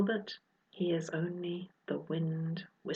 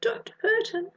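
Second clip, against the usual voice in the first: sniffling plea. Acted. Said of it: "sniffs Phoebe".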